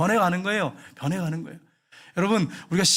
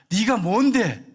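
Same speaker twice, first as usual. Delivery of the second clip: put-on raised, mildly louder teen backtalk; defiant retort; 4 semitones higher, 3 dB louder, much slower than usual. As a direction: act out a daughter talking back. Daughter's defiant retort, acted. (대드는 딸의 말투)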